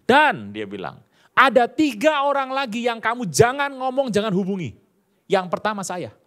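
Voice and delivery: lightly voiced lecturer saying don't call me; supervisor's warning.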